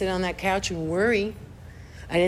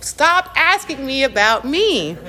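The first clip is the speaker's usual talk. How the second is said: exasperated plea; 6 semitones higher, 5 dB louder, slower than usual. Retold or imitated. Annoyed at sponsor's questions.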